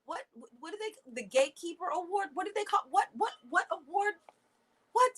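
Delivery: high-pitched, stammering incredulity; baffled disbelief. Real, not acted. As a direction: keep asking in high, bewildered bursts. Baffled by the award's name.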